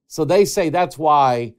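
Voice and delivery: normal talking voice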